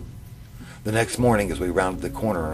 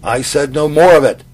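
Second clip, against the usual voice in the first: loud furious scream; 8 semitones higher, 14 dB louder than usual. A narrator's reading. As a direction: scream it down at him. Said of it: "he screamed".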